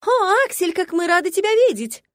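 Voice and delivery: high, loud gushing exclamation; gushing girls' chorus. Acted. Crowd of young ladies greeting the prince, high gushing chorus. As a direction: chirp it like a flock of admiring girls. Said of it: "Хором воскликнула пёстрая стайка".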